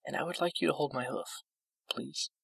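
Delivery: high, hushed, frail request; soft. A narrator's reading. Fluttershy asking Discord to hold her hoof, very soft. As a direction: ask softly, fading on the last word. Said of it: "she said softly".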